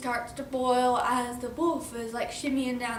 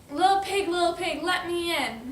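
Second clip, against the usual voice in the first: raised, louder sing-song demand; storybook wolf calling; 5 semitones higher, 5 dB louder than usual. Acted. Wolf at the pig's door, loud chanted demand.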